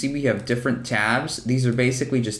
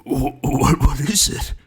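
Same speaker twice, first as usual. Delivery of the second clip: high, loud, slow goofy ghost voice; Slimer voice; 4 semitones higher, 4 dB louder, slower than usual. Acted. Recording a cartoon line as Slimer, goofy ghost voice. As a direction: gurgle it out like a greedy cartoon ghost.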